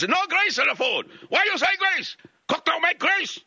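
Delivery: very high-pitched imitation of a scolding restaurant woman; mock screaming. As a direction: screech it in mock outrage, far above your own voice. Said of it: "screaming at me".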